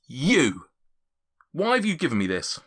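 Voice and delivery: acted indignation. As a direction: confront the culprit, mildly hammed-up. Angry character storms out, accuses Quinch.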